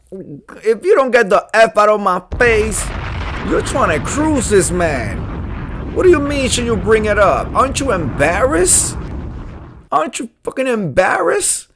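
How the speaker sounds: high, loud, scolding outrage; outraged rant. Real, not acted. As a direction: tear into him, shocked. Host rants at the poster.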